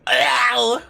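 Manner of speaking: loud yelp of pain; a high startled ow; sharp high cry. Real, not acted. Streamer yelping as the character is hit, loud and high.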